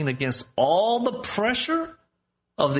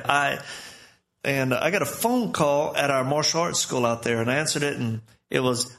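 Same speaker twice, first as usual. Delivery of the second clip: ordinary talk, nothing marked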